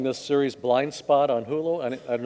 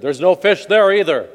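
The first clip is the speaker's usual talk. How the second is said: louder, higher booming announcement; voice from above; 4 semitones higher, 6 dB louder than usual. Acted. Voice from above warns ice fisher, calling loudly. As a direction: call it down from the heavens. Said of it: "and then he heard".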